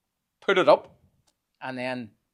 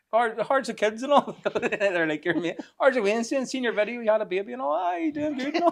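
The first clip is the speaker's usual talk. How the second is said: higher, mock chirpy greetings; cheery locals; 10 semitones higher, 4 dB louder than usual. Regulars in the shop greeting the grumpy man, mock chirpy.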